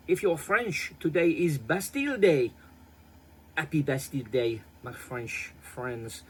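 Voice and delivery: a deliberately bad French accent; terrible French accent. Vlogger greeting French viewers in a terrible French accent. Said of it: "Yes, that is a terrible accent".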